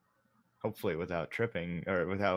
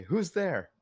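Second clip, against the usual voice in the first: wary call, pitched up high; 8 semitones higher, 4 dB louder than usual. Acted. Nork calls to an unseen voice in the bushes, wary. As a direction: call out high, a touch uneasy. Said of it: "a little bit wary now".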